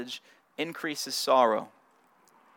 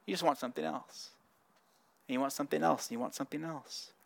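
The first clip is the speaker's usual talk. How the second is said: fading to a hush; 4 semitones lower, 16 dB quieter, faster than usual. Acted. Repeated, fading away.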